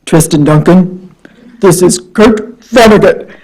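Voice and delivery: slow, weighty booming voice; mildly boomed, drawn-out phone introduction; booming Kurt Vonnegut. Retold or imitated. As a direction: imitate a big booming caller, taking it slowly. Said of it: "this booming voice that says".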